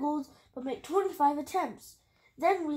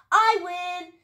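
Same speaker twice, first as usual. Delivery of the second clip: higher, louder cry of victory; raised, triumphant call; shouting; 5 semitones higher, 7 dB louder than usual. A narrator's reading. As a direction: shout it as the winner. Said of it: "she shouted".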